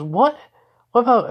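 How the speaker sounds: high, loud, startled objection; startled protest. Acted. Girl told to stay here, startled protest. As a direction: burst out in disbelief.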